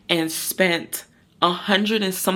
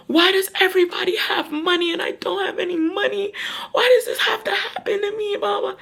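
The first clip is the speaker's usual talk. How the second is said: high, tearful wailing complaint; imitating her crying. Crying to mom on the phone, high and tearful. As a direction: wail it out through sobs.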